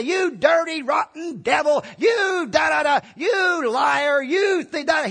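high, loud mock tirade at the devil; mock railing. Preacher showing how Michael didn't address the devil, mock railing. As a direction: hurl exaggerated name-calling. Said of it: "he didn't say".

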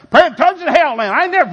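high loud comic yell; exasperated outburst. Retold or imitated. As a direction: blurt it out, fed up.

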